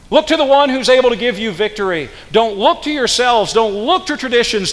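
high, loud climax of the sermon; fervent preaching. Acted. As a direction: drive the point home from the pulpit. Preaches at full force.

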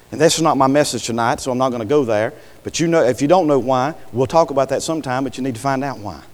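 ordinary delivery

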